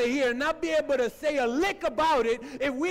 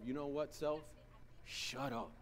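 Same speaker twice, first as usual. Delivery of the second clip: quiet self-talk; 11 semitones lower, 16 dB quieter than usual. Retold or imitated. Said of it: "But tell yourself".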